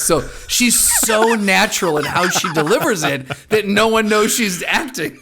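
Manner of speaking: ordinary delivery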